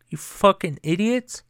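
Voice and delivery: ordinary delivery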